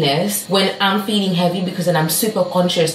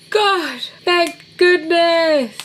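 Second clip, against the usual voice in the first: sharp gasp, then a much higher, slow "Thank goodness"; gasp of relief; 11 semitones higher, much slower than usual. Real, not acted. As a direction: squeal out your relief, drawn out. Steps synced, big relief.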